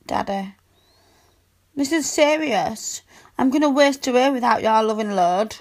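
ordinary delivery

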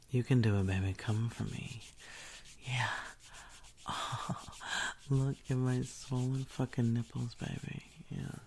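normal talking voice

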